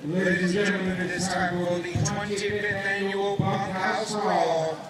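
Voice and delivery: deep, sing-song ring announcer call; boxing ring announcer. Acted. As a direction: stretch each word out like an arena announcer. Ring announcer introduces the match.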